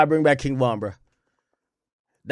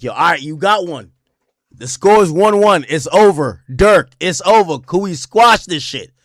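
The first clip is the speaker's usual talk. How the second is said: louder, pleading, slowing on it's over; hammed-up begging that it's over; loud mock plea; 4 semitones higher, 8 dB louder than usual. Rival camp asking [name] for peace, loud mock plea.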